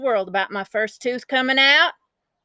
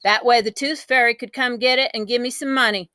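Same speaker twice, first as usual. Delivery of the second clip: usual speaking voice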